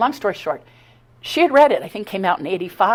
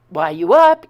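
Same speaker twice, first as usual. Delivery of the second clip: loud; 9 dB louder than usual. Retold or imitated. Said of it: "she's gonna just go".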